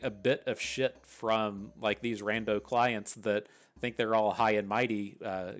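normal talking voice

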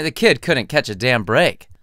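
plain reading voice

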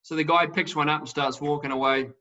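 ordinary talk, nothing marked

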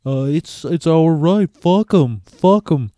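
very drawn-out, halting drummer impression. Famous drummer defending music from stealing, slow drawling impression. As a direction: stretch the words in a mock Danish rock-star drawl. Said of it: "the drummer of Metallica".

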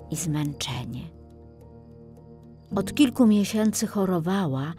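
ordinary delivery, nothing marked

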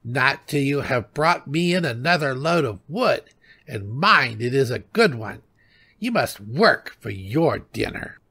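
put-on a touch of the nagging old woman; greedy old wife. A narrator's reading. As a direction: order the husband about. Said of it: "cried the greedy old wife".

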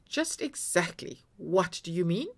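ordinary delivery

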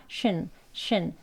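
쉰 slow and soft, as not to say it; 잘못된 쉰 발음; wrong Korean shwin. Shown as a demonstration. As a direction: show 쉰 as the wrong way. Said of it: "쉰이라고 발음하는 건 아닙니다".

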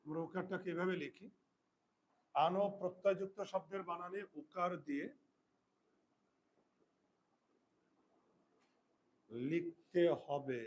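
slow and spaced for writing down; teacher dictating the rule to pupils, slow; slow dictation. Shown as a demonstration. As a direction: dictate word by word.

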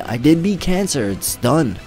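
ordinary talk, nothing marked

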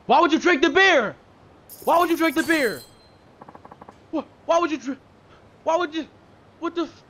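high, loud cries of disbelief; can't believe he drank it; exasperated disbelief. Real, not acted. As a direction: voice jumps up, outraged and baffled. NPC drank the beer.

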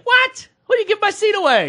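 very high, incredulous outburst; retold shocked outrage. Traveler told the seat was given away, shocked outrage. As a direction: shriek it in comic disbelief.

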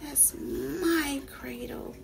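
put-on a boy's hurt thought, said softly; thinking to himself. A narrator's reading. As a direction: drop to a soft, slow inner voice. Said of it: "he thought".

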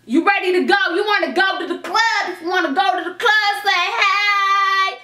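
high, bright party hype, ending sung; sing-song hype call to the club. Retold or imitated. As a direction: pump her up like a hostess calling out.

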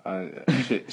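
normal talking voice

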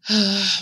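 loud burst of a sigh; big role-play sigh; heavy sigh. Pretend. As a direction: sigh hard, pretending heartache. Sad about sister in role play.

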